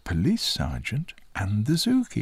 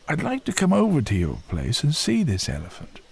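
higher-pitched zookeeper character, keen to visit; raised, helpful character voice; interested zookeeper; 8 semitones higher, 4 dB louder than usual. A narrator's reading. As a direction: voice the keeper up high, offering help.

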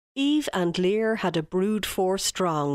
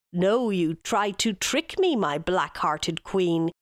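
ordinary delivery

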